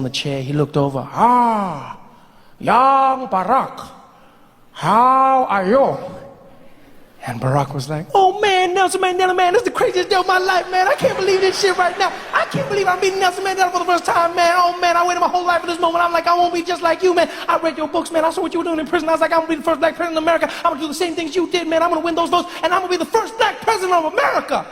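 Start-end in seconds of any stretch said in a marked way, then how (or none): 1.1-6.0 Mandela voice. Acted. 8.1-24.6 put-on high, fast, excited young Barack voice; excited young Barack gushing to his idol, high and fast; high fast young Obama. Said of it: "Not with that voice".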